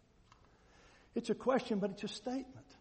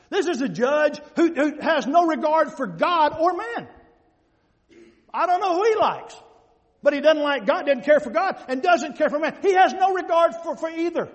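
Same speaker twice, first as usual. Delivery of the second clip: loud, emphatic pulpit delivery; full-force preaching; 3 semitones higher, 9 dB louder than usual. Real, not acted. Preacher telling congregation about the unjust judge, loud and emphatic. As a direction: drive the point home loudly.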